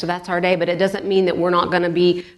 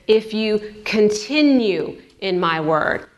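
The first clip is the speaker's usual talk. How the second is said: "continue" stretched slowly and punched; slow down and lean hard on "continue"; stress on continue; 2 semitones higher, slower than usual. Shown as a demonstration. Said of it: "we could say it like this".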